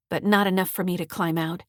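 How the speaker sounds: ordinary delivery, nothing marked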